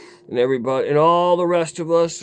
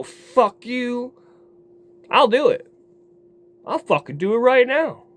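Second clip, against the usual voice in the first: defiant outburst; 7 semitones higher than usual. Retold or imitated. Drunk man daring his friends he'll call rehab, defiant. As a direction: fire it back as a dare. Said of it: "I'm gonna go".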